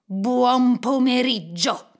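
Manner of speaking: loud, drawn-out, insistent send-off; exasperated dismissal. Acted. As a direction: stretch each word to shut him out. Uncle firmly dismisses his nephew. (congedo esasperato, forte e scandito)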